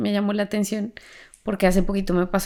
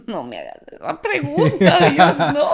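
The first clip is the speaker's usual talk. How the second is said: laughing mock plea; 6 semitones higher, 5 dB louder than usual. Retold plea to God, laughing.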